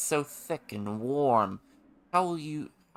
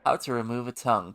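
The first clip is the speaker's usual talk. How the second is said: usual speaking voice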